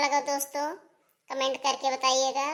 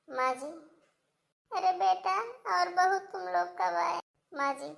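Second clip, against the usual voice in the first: pleading daughter-in-law; 5 semitones higher than usual. Acted. Daughter-in-law begging forgiveness.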